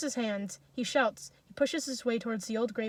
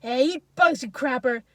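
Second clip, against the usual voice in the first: loud, raised insult; forceful cursing shout; furious yell; 2 semitones higher, 9 dB louder than usual. A narrator's reading. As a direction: hurl it angrily at the animal. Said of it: "Misha yells furiously at the mare".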